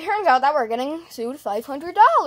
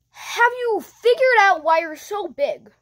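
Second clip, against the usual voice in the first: usual speaking voice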